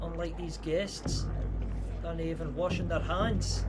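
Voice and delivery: slightly lifted Scottish lilt; reply politely in a put-on Scottish accent. Streamer thanks a Scottish viewer, in Scottish accent. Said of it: "that accent's actually good".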